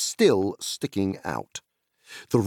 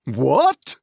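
loud, high startled shout; 6 semitones higher, 6 dB louder than usual. Acted. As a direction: bark it in surprise. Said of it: "shouted Tom Bombadil".

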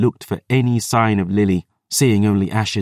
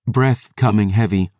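ordinary delivery

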